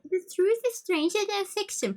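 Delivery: very high mocking singsong of truth; mock the cliché in a high voice; mocking sing-song. Real, not acted. Mocking the quoted line.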